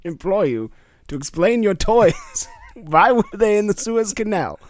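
put-on German accent. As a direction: press the question as a German sailor. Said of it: "I'm from West Germany".

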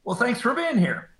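light joke. Retold or imitated. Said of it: "I pull it out of my mouth and I said".

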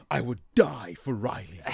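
slow, lightly voiced dreamy heartthrob; a hint of a swooning pop-star boyfriend, slowly; imaginary boyfriend's line. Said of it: "his only line is".

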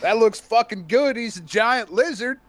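a light, hammed-up southern voice that ends in a shout. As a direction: play a hyped southern moviegoer. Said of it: "a southern twang".

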